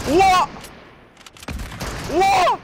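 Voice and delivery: loud, high yell of disbelief; stunned gamer yelling at the screen; shocked shout. Real, not acted. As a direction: blurt it out at full volume. Shock at game event.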